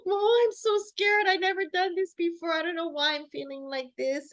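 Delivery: high, loud, hammed-up frightened voice; mock scared. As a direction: play up the panic going into the scan. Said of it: "I'm like".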